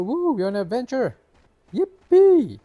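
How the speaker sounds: high squealing cheer; excited cheer. Acted. Cheering at start of adventure.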